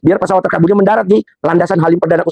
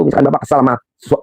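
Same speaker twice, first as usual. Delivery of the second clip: usual speaking voice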